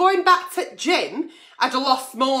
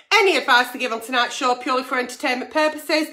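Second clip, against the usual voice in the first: usual speaking voice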